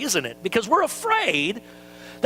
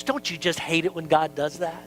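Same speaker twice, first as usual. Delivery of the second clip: ordinary delivery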